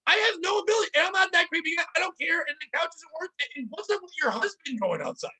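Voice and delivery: high, disbelieving mock rant; incredulous rant. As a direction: pile the questions on, voice climbing.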